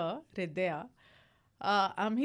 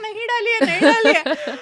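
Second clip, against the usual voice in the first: higher, louder laughing mock cry of the plants; plants screaming; 8 semitones higher, 12 dB louder, much faster than usual. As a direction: wail it like the thirsty plants, with a laugh. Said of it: "माझे plants ओरडतात".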